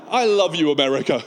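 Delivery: ordinary talk, nothing marked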